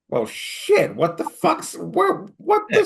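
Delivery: high, flustered sputter; acting out stunned confusion; retold shock. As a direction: sputter in rising disbelief. Retelling his stunned reaction to episode.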